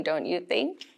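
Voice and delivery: ordinary delivery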